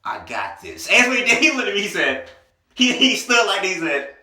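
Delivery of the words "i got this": low, softer, unhurried confidence; cool, smooth brother. Retold or imitated. Boyfriend offers to defuse it, low cool assurance. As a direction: play the unbothered man taking charge. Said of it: "like, a cool, smooth brother".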